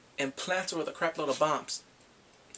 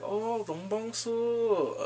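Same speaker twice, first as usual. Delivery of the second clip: drawn-out, higher, creepy calling of a name; 6 semitones higher, much slower than usual. Retold or imitated. Kidnapper taunting Bongsu, creepy mock croon. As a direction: imitate a creep slowly cooing her name. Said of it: "all creepy and everything".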